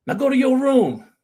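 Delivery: high, loud shouted command; dad's order again. Retold or imitated. Preacher replays dad's order to his son, shouted high and loud. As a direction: shout it like the furious father. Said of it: "meaning grieve alone".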